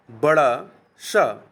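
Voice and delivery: बड़ा सा drawn out slowly, each sound clear; slow demo of बड़ा सा. Shown as a demonstration. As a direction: spell out बड़ा सा very slowly for the class. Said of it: "ये जो है सही है". (बड़ा सा को धीरे-धीरे साफ़ बोलें)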